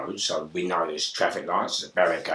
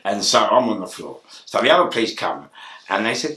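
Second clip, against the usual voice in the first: normal talking voice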